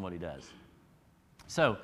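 ordinary delivery

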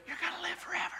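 hushed unvoiced promise; soft whispered reassurance. Real, not acted. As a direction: whisper it like a secret. Secret assurance whispered.

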